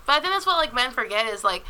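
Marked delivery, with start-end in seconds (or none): none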